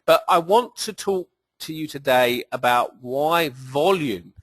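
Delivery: ordinary delivery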